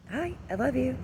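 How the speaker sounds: high, sweet "I love you"; kids' sign-off. Retold or imitated. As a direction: sing it out like a little kid. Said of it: "end it with".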